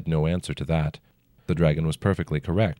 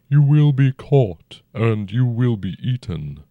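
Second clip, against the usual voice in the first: dragon voice; 5 semitones higher, 6 dB louder than usual. A narrator's reading. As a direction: speak as a huge old dragon, unhurried. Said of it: "the dragon said".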